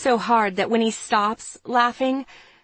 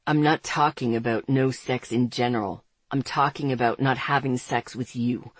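lower, smooth male voice; Andre's voice; 8 semitones lower than usual. A narrator's reading. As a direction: read him low and cool.

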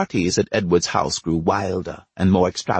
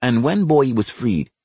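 usual speaking voice

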